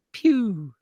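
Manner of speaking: high, falling "pew" sound; imitated gunshot. Comic telling a staged-shooting bit, mock gunshot.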